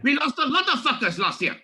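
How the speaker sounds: hammed-up Pink Panther French; French accent. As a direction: ham up a French boss opening a meeting. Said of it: "how the French speak".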